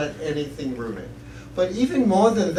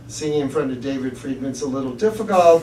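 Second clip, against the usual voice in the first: ordinary talk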